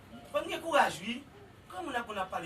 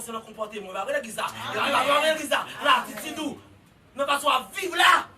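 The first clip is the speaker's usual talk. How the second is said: loud, rising sermon climax; full-force preaching; 4 semitones higher, 11 dB louder than usual. Acted. Preacher shouting to the congregation about worship, full force.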